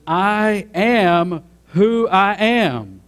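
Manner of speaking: God's self-declaration, preached big and slow; solemn declaration. Acted. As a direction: declare it slowly, each word drawn out. God's declaration at the bush quoted.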